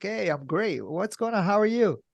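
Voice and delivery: high, bright put-on friendliness; mock upbeat small talk; forced cheer. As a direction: sell the fake enthusiasm. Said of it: "I'm always like".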